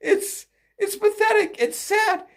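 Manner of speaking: high voice, weak from laughing; squeezed out after a laughing fit. Real, not acted. Commentator pitying the party, helpless with laughter. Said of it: "I was laughing so much earlier".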